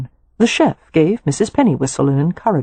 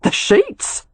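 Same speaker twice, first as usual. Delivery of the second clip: succinct. Acted. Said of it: "she said succinctly".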